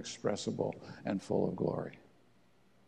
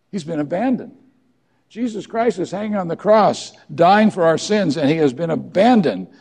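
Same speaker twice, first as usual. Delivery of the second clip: impassioned preaching; 7 semitones higher, 11 dB louder than usual. Real, not acted. Preacher building to full force.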